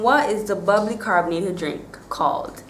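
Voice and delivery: normal talking voice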